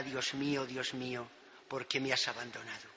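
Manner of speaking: the preacher softly translating Jesus's cry; quiet translation. Acted. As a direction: murmur it low and hushed. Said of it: "que significa".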